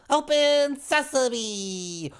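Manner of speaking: very high, slow, drawn-out magic command; alien voice. Acted. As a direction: intone it like an alien spell. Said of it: "an alien voice beckoned the doors".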